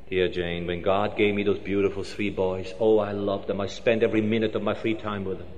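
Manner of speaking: gentle explanation. Acted. Grieving father explains gently.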